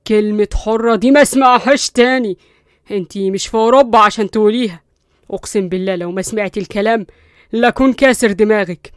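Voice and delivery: loud menacing warning; angry threat. Acted. Qusay gripping Linda's arm, loud angry threat. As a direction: threaten her, seething.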